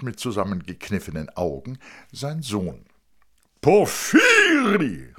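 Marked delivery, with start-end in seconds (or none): 3.6-5.1 drawn-out, higher, louder call across the platform; a glad slow call of a name. Acted. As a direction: fat man hailing an old friend, slow. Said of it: "rief der Dicke".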